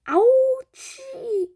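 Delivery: very high, loud squeak of a hen; squeaky hen. A narrator's reading. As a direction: squeal it like a tiny startled bird. Hen squeaks after medicine.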